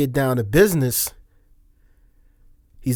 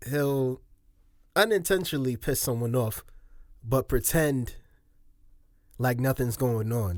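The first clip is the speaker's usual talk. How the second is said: ordinary talk, nothing marked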